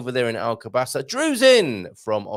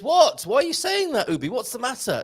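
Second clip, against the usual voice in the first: high, surprised question; mock surprise; 11 semitones higher than usual. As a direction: act puzzled and surprised.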